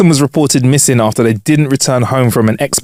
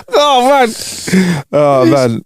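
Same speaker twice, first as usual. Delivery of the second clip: laughing groan; 5 semitones higher, slower than usual. Retold or imitated. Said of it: "I'm like".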